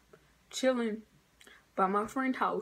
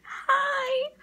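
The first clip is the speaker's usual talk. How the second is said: high, airy fake-sweet hi; mock polite hi. Teen mimicking the snitching neighbor's sweet greeting, high. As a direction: chirp it like a two-faced friend.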